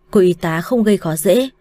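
ordinary delivery

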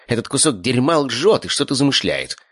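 high, indignant creature voice; rat familiar. A narrator's reading. Rat warning master about lying uncle, indignant creature.

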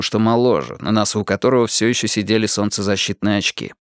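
usual speaking voice

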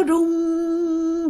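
last word stretched very long and high; drawn out. Acted. Telling how grandpa just kept shivering, drawn out. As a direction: hold the final word on a high, level note.

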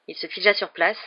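ordinary delivery

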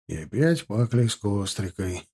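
low, resigned remark; sighing. A narrator's reading. Sergeant finding tow in the musket lock, sighing remark. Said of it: "Вздохнув, заметил Тимофей".

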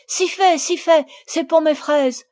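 loud, high, vehement interruption; quick-tempered insistence. A narrator's reading. Camille silences Marguerite before she tells, vehement. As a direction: cut in briskly to silence her. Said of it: "avec vivacité".

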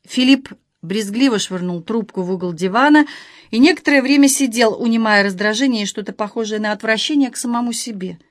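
ordinary delivery, nothing marked

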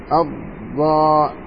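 slow, with the first letter of الضاء drawn out; slow doubled dad. Shown as a demonstration. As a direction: draw out the doubled sound in الضاء. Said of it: "الضاد مشدد". (نطق الضاد مشددة ببطء)

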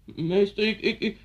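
put-on high, stammering child's plea; frightened pupil. Former pupil re-enacting fear of the cane, stammering. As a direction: stumble helplessly over the first word. Pupil pleading before caning.

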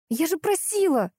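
high-pitched, groaning complaint; plaintive wail, lightly done; moaning reproach. A narrator's reading. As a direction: moan it reproachfully. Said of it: "простонала Лиза".

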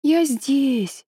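put-on delirious child pleading, high; feverish. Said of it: "ребёнок в бреду". (бредовая мольба ребёнка, высоко)

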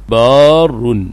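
بار as baaa-rrun, slow long aa; merged ra. Shown as a demonstration. Teacher showing the merged result, بار said slowly as baarrun. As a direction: draw out بار, then double the r. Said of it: "وأدغمت في الثانية".

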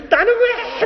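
very high, laughing complaint; walking out in a huff, whining why me with a laugh; whiny protest. Retold or imitated. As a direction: squeak it like a sulking kid. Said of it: "막 이러면서 나가고 싶었는데".

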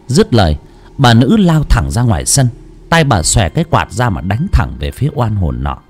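usual speaking voice

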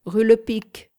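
calling a cab, loud; loud call to a cab driver. A narrator's reading. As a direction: hail the coachman from the kerb. Narrator hails a cab.

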